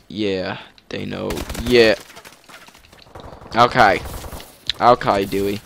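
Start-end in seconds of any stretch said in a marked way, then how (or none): 0.9-1.3 softer, hushed they know; quiet teasing. Pretend. Gamer whispering about unaware enemy, hushed teasing. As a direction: murmur it like a secret.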